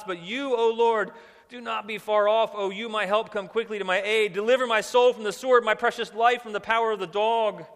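loud, high impassioned scripture reading; preacher voicing a psalm of desperate pleading; crying out. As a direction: cry it out to God as an urgent plea. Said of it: "He's crying out".

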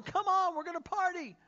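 high, loud party invitation; calling the whole town in, up and bright; excited call. Retold or imitated. Father invites everyone in town, excited and high.

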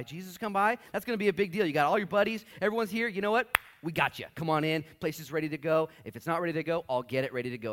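high, loud eager hostess; bustling Martha. Retold or imitated. Martha welcoming Jesus and friends, high and eager. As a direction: welcome the guests in a rush.

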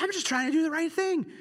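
a wronged man crying out to God; distressed pleading. Retold or imitated. Pastor voicing David hunted by Saul, anguished plea. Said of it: "He's like".

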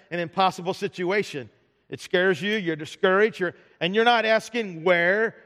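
usual speaking voice

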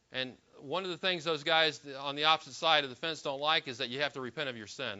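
ordinary delivery

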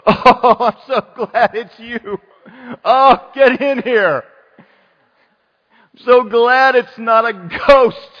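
high, loud, hammed-up gladness; overjoyed relief. Preacher playing disciples welcoming Jesus aboard, overjoyed relief. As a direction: gush with relief, stretching the words out. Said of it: "they were glad to take him".

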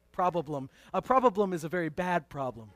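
"Problem" stretched to prob-ub-lem; extra syllable. Shown as a demonstration. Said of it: "It's an extra syllable problem".

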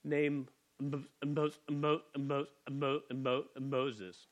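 stuttering for effect, catching on the name; put-on stutter. Shown as a demonstration. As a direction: stammer the first syllable over and over. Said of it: "I did that just for the effect of the stuttering".

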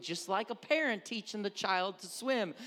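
ordinary talk

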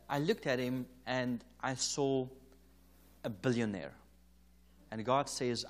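ordinary talk, nothing marked

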